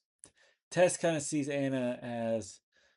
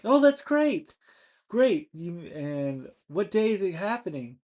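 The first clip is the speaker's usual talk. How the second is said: much higher and louder fake cheer; mock delight; 5 semitones higher, 6 dB louder than usual. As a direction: gush over the news with put-on joy. [name] mock-cheers Anna's news.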